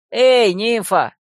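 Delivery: loud, drawn-out call. A narrator's reading. Fox boy calling after the departing nymph, shouting. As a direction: hail someone walking off. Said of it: "и крикнул".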